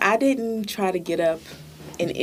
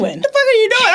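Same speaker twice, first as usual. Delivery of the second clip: high loud mock shriek of a girl; hammed-up offended woman; mock outraged girl; 6 dB louder than usual. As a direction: squeal it like she's offended.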